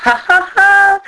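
high, loud stagey laugh; gloating ha-ha-ha on a high pitch; burst of laughter. A narrator's reading. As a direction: crow with acted laughter. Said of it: "elle éclata de rire".

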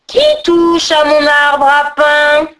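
slow, loud, drawn-out growl; growling ogress. A narrator's reading. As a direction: bellow menacingly like a child-stealing hag. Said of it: "gronda-t-elle".

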